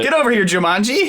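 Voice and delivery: high, quick dog call; calling a lost pet. Pretend.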